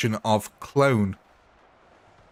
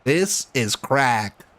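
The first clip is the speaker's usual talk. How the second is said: slow, odd put-on manchild voice; hammed-up slow goofy voice; Pee Wee Herman impression; much slower than usual. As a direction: drag the words out like a goofy TV manchild. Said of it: "Pee Wee Herman".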